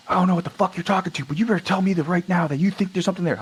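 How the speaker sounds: high, fast imitation of a demand; irate major. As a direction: rattle it off like an irate officer. Acts irate major demanding an answer.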